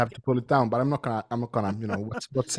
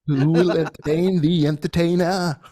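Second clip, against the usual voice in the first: drawn-out, higher, louder mock solemn line; mock profound; 6 semitones higher, 4 dB louder, slower than usual. Mocking a pompous lyric to friends, slow, higher and louder. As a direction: quote the lyric as if it were deep, slowly. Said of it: "Oh, so deep".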